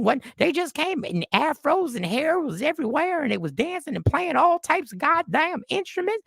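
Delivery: put-on high-pitched, worked-up country accent; bad country accent. Bar regular alarmed by the Super Bowl show, hammed-up high country accent. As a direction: rant as an alarmed Southern country complainer. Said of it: "That is the worst country accent ever".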